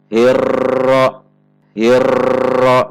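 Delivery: "Hirro" with a loud, long, steady rolled r; even-pressure long trill. Shown as a demonstration. Example of same pressure, too much trill. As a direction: hold "Hirro" on one even, buzzing trill. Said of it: "tekanannya sama dan getarannya terlalu banyak".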